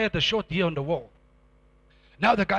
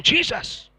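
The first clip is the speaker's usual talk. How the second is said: high, moderately raised mock shout; retold shout; 9 semitones higher, 3 dB louder than usual. Preacher quotes his wife's account, mild mock shout. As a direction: call out the name, held back from full volume. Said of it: "and I'm shouting".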